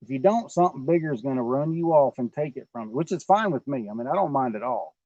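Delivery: normal talking voice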